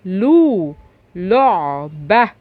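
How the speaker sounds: "لعبة" drawn out very slowly; slow demo reading. Shown as a demonstration. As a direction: stretch out "لعبة" for the kids. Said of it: "اللو اللي عليها ضمة".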